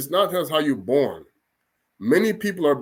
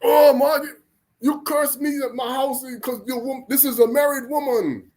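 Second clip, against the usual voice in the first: cursed man's complaint; 8 semitones higher than usual. Acted. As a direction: wail up high about being cursed. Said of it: "this happened twice".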